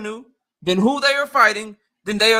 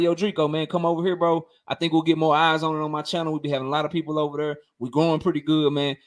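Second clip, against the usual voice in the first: lower imitated self; 8 semitones lower than usual. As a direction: lower voice for his own pitch to Drico. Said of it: "I'm like".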